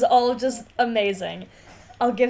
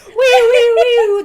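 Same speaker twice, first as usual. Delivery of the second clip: excited squeal; 11 semitones higher, 11 dB louder, slower than usual. Real, not acted. Mock alarm, laughing excitement.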